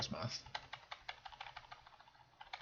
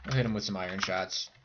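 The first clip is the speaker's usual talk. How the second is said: ordinary delivery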